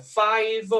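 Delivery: high, drawn-out "five"; trochee stress. Shown as a demonstration. Lecturer scanning the lyric, high slow "five" as trochee. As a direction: stretch "five" over a strong beat and a weak one. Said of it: "é também um troqueu".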